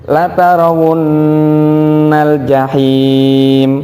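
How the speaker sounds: slow chanted tartil recitation; drawn-out Quranic chant. Shown as a demonstration. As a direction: recite slowly and melodically with tajwid. Teacher recites Quran verse.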